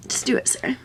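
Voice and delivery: whispered pep talk. Real, not acted. Urging herself under her breath.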